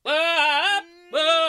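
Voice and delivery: very high, loud double whoop; imitated joyful shrieks; silly whooping. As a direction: holler it like excited fools. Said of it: "get out of the car and be like".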